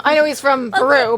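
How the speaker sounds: ordinary delivery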